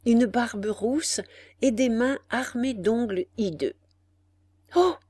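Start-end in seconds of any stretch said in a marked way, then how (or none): 4.8-5.0 cry of fright. A narrator's reading. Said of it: "cria la veuve".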